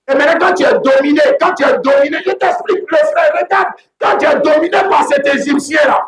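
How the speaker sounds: fiery sermon peak; preaching at full force. Acted. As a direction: drive it home to the congregation. Preacher building to a shout.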